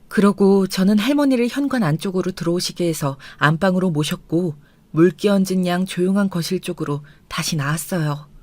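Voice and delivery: usual speaking voice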